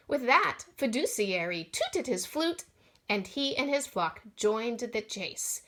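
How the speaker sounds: usual speaking voice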